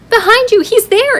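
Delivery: high and loud, pointing out; excited. Acted. Matt excitedly telling classmates the bear is behind them. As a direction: call it out, thrilled.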